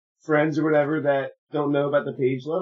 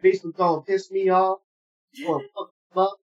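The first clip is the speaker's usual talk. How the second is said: imitating Nevermore pissed off; 5 semitones higher than usual. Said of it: "that's how you know Nevermore's pissed off when she goes".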